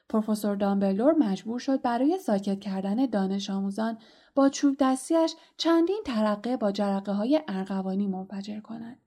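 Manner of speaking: ordinary delivery, nothing marked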